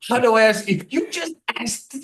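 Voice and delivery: high-pitched mock incredulity; hammed-up exasperated squeak; snarky. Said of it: "he's normally pretty snarky".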